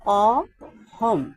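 অহম stretched out very slowly, each sound drawn long; slow demo word. Shown as a demonstration. Teacher to students learning Sanskrit, drawn-out অহম. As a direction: say অহম slowly for the class to copy. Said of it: "সংস্কৃত হবে".

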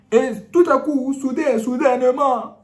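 loud, high and slowed; dramatic shout. Acted. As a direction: burst out dramatically. Preacher dramatizes the sudden ambush.

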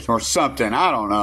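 ordinary talk

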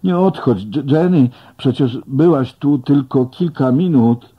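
loud, high, fast pleading; frantic plea to Jenny as she fades. A narrator's reading. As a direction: beg her not to leave. Begging vanishing Jenny to stay.

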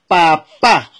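papá in two parts, last A punched loud; stress on final A. Shown as a demonstration. Father coaching his son to say papá, stress on last A. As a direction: draw out papá slowly, hitting the final á hard. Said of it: "con acento en la A".

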